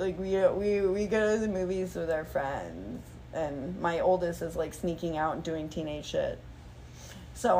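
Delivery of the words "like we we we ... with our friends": put-on low, fast, bored teen voice; bored teenagers. Her teenage kids brushing her off, low and bored. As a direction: toss it off like an eye-rolling teen. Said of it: "They're like".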